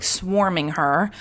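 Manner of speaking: ordinary delivery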